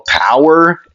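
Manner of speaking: mock yell. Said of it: "Mirio would just yell".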